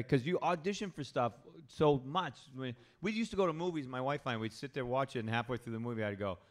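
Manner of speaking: ordinary delivery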